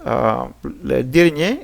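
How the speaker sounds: usual speaking voice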